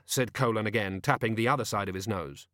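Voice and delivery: ordinary delivery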